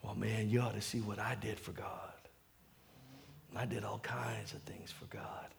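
low, hushed brag; boasting aside. Acted. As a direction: murmur a boast to people behind you. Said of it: "he was talking to the people behind him".

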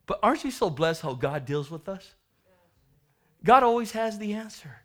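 ordinary delivery

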